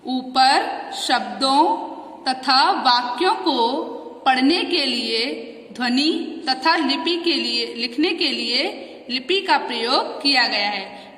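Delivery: slow, careful reading of the line. Shown as a demonstration. Teacher re-reading the textbook line slowly. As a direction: read it again slowly for the class. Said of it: "reads textbook line again slowly".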